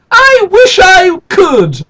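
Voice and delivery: put-on high, loud, slow childish shout; girl yelling back at the brownie chief; shouted wish. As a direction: shout it like a defiant little girl. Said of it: "she said".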